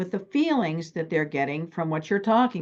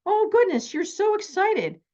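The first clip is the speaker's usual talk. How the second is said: high-pitched, thrilled exclamation; squealy mock amazement at someone's excitement; gushing excitement; 12 semitones higher than usual. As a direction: gush in a much higher voice. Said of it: "So I said to him".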